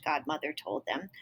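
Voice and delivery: plain reading voice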